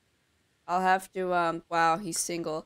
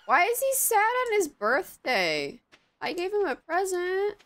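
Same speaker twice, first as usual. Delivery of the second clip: whiny squeak; 12 semitones higher than usual. Real, not acted. Baffled villager sad on birthday.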